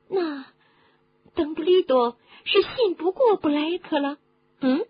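high-pitched, prying questioner; inquisitive listener. Acted. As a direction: press for the answer in a high character voice. Voices a questioning listener in the story. (高声追问的角色)

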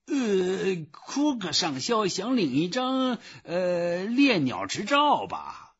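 high, hesitant clerk voice; high-pitched, bumbling official; puzzled phone clerk. A narrator's reading. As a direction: venture a guess in a high voice. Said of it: "电话里的声音".

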